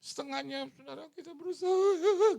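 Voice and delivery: high, loud preachy call; rally them in a high voice; imitating the pastor. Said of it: "Karena dia bilang".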